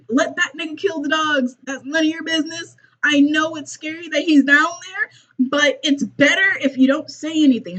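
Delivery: high-pitched, half-laughing telling-off; exasperated scolding. Pretend. Host warning Ellie she's about to die, comic exasperation.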